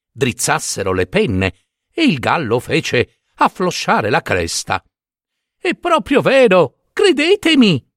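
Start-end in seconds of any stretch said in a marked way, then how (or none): 5.6-7.8 much higher, louder squawking plea; shrill-pitched plea to be believed; a hen begging the flock to believe her; insistent hen. A narrator's reading.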